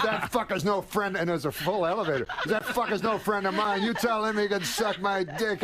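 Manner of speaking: high, laughing through mock anger; laughing Rodney rant. Rodney in a full elevator, mock anger with laughter. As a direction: rant while cracking up. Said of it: "He goes".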